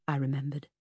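usual speaking voice

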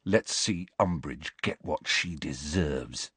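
soft, slow murmur; boy whispering to his friend in class; hushed gloating. A narrator's reading. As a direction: quietly relish it. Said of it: "whispered Ron".

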